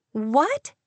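sharp startled "What?"; surprised cry. A narrator's reading. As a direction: blurt it out in surprise. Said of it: "she cried".

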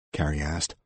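plain reading voice

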